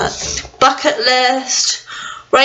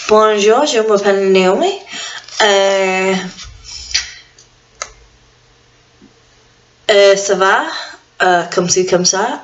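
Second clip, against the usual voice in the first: playful French phrases for friends to guess; hint of a put-on French voice; mock French. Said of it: "That's French".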